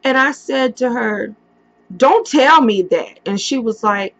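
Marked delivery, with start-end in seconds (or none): 2.0-3.2 pleading denial. Retold or imitated. Begging mother to deny the news.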